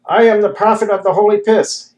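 high, loud, ecstatic proclamation; ecstatic shout. A narrator's reading. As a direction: shout it like a man in rapture. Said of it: "he shouted ecstatically".

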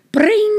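high ringing alarm call; alarm bell. A narrator's reading. Fire station alarm going off, high ringing.

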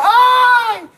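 drawn-out high shouted ay; mock yell. Guest retells calling out to a lady in a high, drawn-out yell. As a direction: call it out high, loud and slow. Retold cry at wife's fan.

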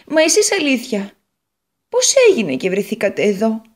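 an eager little potato telling her woes; Potato character voice. Acted. Potato asks guitar.

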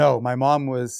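normal talking voice

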